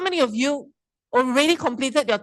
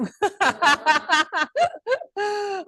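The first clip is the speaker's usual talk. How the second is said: high laugh at her own slide mistake; embarrassed laugh; 8 semitones higher than usual. Real, not acted. Speaker laughing at her own slide mix-up, embarrassed laugh. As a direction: laugh off the clicking blunder.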